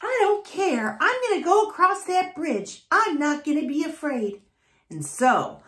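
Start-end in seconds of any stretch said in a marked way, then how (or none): 0.0-4.4 high, small and brave; squeaky determined little goat. A narrator's reading. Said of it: "little Billy Goat Gruff was feeling so hungry".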